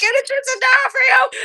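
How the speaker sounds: high laughing shout; thrilled fan shouting with laughter; excited shout. Retold or imitated. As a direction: yell it out, giddy with joy. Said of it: "losing my mind".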